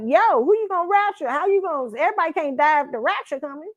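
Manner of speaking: put-on high little girl questioning; child voice. Little girl arguing with grandpa about the rapture, high. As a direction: reason it out like a small kid. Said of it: "I'm like".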